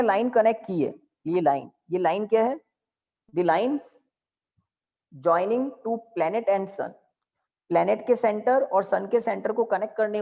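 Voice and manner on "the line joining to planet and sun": dictating. Shown as a demonstration. Dictating definition.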